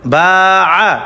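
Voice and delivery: বা held long, আ cut short; sustained বা followed by a quick আ; long then short vowel. Shown as a demonstration. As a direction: stretch বা, then give আ briefly with no drawl. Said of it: "তাড়াতাড়ি করিয়া পড়িতে হয়".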